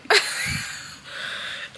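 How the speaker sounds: short unvoiced laugh; soft airy chuckle; breathy laugh. Real, not acted. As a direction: laugh it off under your breath. Laughs at the plot turn.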